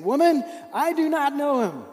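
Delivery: high, loud protest; defensive denial. Acted. Peter denies knowing Jesus to the woman, loud and defensive. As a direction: push the accusation away hard.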